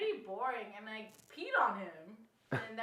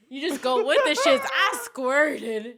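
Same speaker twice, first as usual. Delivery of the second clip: laughing; 3 semitones higher, 12 dB louder, slower than usual. Real, not acted. Laughing retelling.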